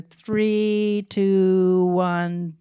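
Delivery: long, held, level notes on each number; on-set countdown. Retold or imitated. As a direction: count down slowly to ease them into it. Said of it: "on set, I would say".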